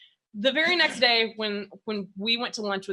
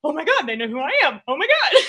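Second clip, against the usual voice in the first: high, quick gushing; secret starstruck thrill; 4 semitones higher, 5 dB louder, faster than usual. Retold or imitated. Host retelling her inner thrill at being recognized, excited gushing. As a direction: gush like a starstruck fan. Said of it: "secretly, I was like".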